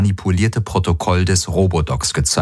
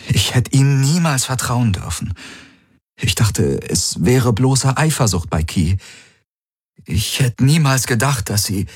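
halting, higher-pitched show of remorse; feigned dismay; 5 semitones higher than usual. A narrator's reading. Valyr apologising to Ylana at her bedside, feigned dismay. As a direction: apologise haltingly, as if deeply stricken. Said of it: "zeigte eine Maske der Betroffenheit".

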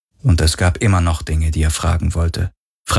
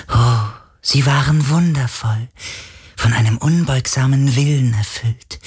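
high, otherworldly spirit, wistful; a dying spirit lost in memory; ancient spirit voice; 7 semitones higher than usual. Acted. The sea spirit speaks.

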